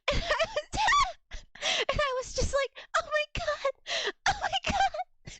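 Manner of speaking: high-pitched, laughing mock panic. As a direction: squeal it through helpless laughter. Laughing through retold panic at work.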